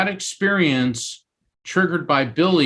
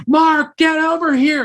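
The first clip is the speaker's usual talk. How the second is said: high, raised call across a room; urgent high-pitched holler, acted out; 4 dB louder than usual. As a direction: summon someone from far off, pitched way up. Calling him across the room.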